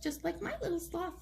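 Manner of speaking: ordinary delivery, nothing marked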